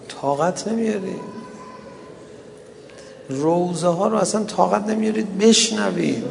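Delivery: mourning recital. Acted. Preacher mourns Fatima before the congregation, plaintive lament. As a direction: plead in grief, stretching the phrases.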